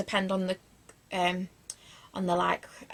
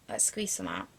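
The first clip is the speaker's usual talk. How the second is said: whispering; 7 dB quieter than usual. Real, not acted. Squeezing product out, aside.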